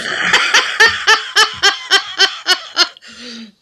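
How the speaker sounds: loud high laugh, falling away; burst of laughter. Real, not acted. Friend laughing at the joke about the neighbour next door.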